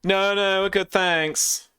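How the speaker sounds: airy smug posh hipster sign-off. Acted. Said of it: "Posh hipster wanker voice".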